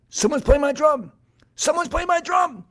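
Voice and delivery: very high, loud outraged cat cry; alarmed cat. A narrator's reading. Cat hears his drum from the field, high alarmed yell. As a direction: squawk it out in high-pitched alarm.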